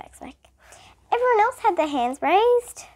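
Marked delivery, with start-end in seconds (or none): 1.1-2.8 high, loud protest; raised-voice schoolboy exclamation; exclaimed. A narrator's reading. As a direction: exclaim it in self-defence, pitched high. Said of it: "Stephen exclaimed".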